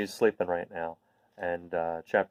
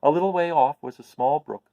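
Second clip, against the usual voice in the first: put-on higher, lively read-aloud voice for kids; bright animated story reading; storybook narration; 5 semitones higher, 5 dB louder than usual. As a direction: paint the scene with wonder, reading to children. Reads the book chapter aloud.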